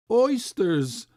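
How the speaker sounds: loud high cry. A narrator's reading. Boy crying out to his father for oysters. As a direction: call out, tugging at him. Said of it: "I cried".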